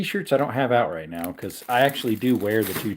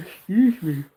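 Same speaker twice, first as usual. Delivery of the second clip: through a yawn; 8 semitones higher, slower than usual. Real, not acted. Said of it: "I'm yawning so much".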